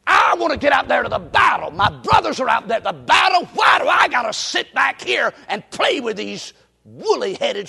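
loud, exasperated protest; fed-up complaint. Acted. David grumbles about sitting back with sheep, exasperated. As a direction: gripe at full volume, acting out young David.

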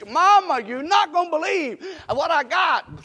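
put-on high, loud, breathless boy calling mama; excited little boy. Little boy running home to tell his mama, excited.

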